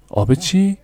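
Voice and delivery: high startled question; be caught off guard; surprised. Acted. Shopkeeper surprised by odd drink request, high startled.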